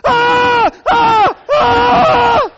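very high, very loud screaming; terrified shrieks at the open trunk; shrieking scream. Retold or imitated. Wife finds husband in trunk, piercing scream. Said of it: "Her response went more like".

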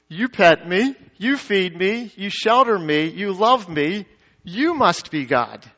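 devoted dog, phrases lifting at the ends; adoring dog. Acted. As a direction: build the list with rising tones, then land slowly on the conclusion. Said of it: "A dog says".

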